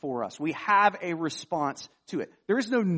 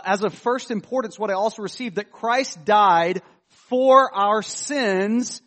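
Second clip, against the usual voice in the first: solemn scripture reading; 4 semitones higher, 6 dB louder than usual. Acted. Reads scripture with force.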